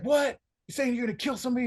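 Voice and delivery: high, fast mock disbelief; half-mocking high-pitched outrage, quick; mock incredulous. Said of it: "he was like".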